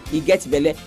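ordinary talk

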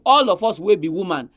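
usual speaking voice

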